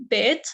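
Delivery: "beit" said as "bayt", ending on a clear t; beit with final t. Shown as a demonstration. Teacher demos the Lebanese word for house, full t. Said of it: "might just pronounce it".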